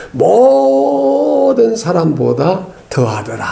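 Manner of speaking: "모든" with a very long, high 모; drawn-out first syllable. Shown as a demonstration. Preacher quoting scripture on Moses, long-held start of "모든". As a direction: stretch the first syllable of "모든" before going on. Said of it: "모를 좀 오래 해야 되는데".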